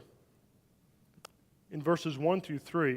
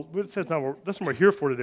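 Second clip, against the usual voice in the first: ordinary delivery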